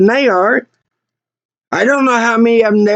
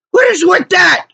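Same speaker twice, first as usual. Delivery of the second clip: high-pitched incredulous cry; outraged; 8 semitones higher than usual. Real, not acted. Customer venting about threats from garage workers, outraged. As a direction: burst out with it, much higher. Exasperated rant, louder repeat.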